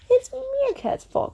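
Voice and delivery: high-pitched accusing cry. A narrator's reading. Monkeys blaming Meerkat before Elephant, high-pitched cry. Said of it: "cried the monkeys".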